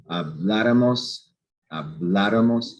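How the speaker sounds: "habláramos" drawn out slowly, weight on "blá"; stress on third-last syllable. Shown as a demonstration. Teacher shows why the accent is written, "habláramos" slowly stressed on "blá". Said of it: "This is stressed".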